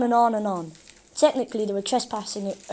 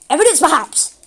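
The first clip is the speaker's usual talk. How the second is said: eager explaining; 6 semitones higher, 9 dB louder than usual. A narrator's reading. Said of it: "explained the police officer".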